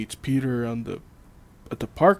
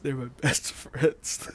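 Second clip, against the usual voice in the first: laughing imitation; slower than usual. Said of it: "Look at the way he says".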